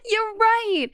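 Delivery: high laugh spilling into the words. Acted. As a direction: laugh at being caught out. Caught out, laughing.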